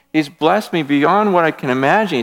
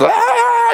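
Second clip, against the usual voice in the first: sudden high exasperated "Ah" cry; 3 dB louder than usual. Retold or imitated. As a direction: throw up your hands with a sharp high yelp. Exasperated at chaotic household.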